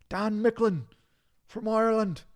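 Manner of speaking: put-on high, loud, botched Irish accent; attempted Irish accent. Streamer trying a viewer's name, shaky-fit Irish accent. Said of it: "I don't think that's an Irish accent".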